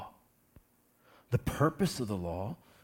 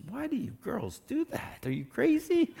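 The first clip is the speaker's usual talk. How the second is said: teasing disbelief; 12 semitones higher than usual. Real, not acted. Teasing about magnifying mirrors.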